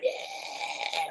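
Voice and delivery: long mock yell held on one word; Tarzan yell. Retelling a rider's dad cheering from a digger bucket, mock Tarzan yell. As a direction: imitate a wild man's triumphant roar, lightly. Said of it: "He was like Tarzan".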